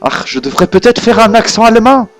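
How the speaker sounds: normal talking voice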